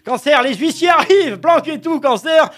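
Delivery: high, loud urgent warning; hammed-up panic; mock alarm. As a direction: sound the alarm, bailiffs coming. Dramatic urgent warning to Cancer.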